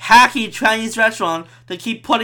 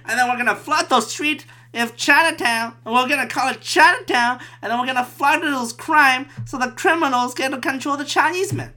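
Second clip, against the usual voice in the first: put-on higher old-timey schemer voice; mock Victorian; 5 semitones higher than usual. Victorian boss planning Chinatown, old-timey put-on voice. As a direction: keep up the put-on old-fashioned voice. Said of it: "it's a Victorian".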